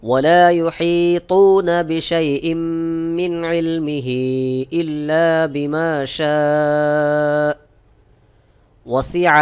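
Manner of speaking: slow chanted recitation; teacher models the verse in slow tajweed; Quranic recitation. Shown as a demonstration. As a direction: recite it slowly and melodiously.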